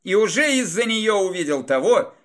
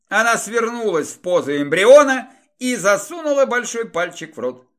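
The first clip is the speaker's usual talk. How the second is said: plain reading voice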